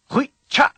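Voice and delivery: Hui cha with both syllables stopped short; high, loud Hui cha chopped off at the end; abrupt cut-offs. Shown as a demonstration. Teacher has learners listen, Hui cha cut off abruptly. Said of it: "has it at the end as well".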